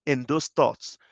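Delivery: ordinary talk, nothing marked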